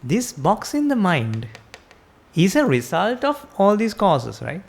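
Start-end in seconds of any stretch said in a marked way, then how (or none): none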